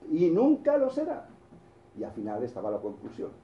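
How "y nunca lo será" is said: high, slow punchline; roadside sign punchline. Retold or imitated. Preacher reading the sign's punchline, high and slow. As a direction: land the sign's twist slowly.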